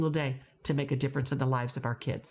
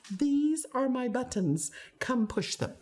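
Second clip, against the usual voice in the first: slow, higher-pitched announcement; mock invitation; 8 semitones higher, slower than usual. Teacher showing kids the buttons, mock inviting. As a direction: present it slowly as a mock invitation.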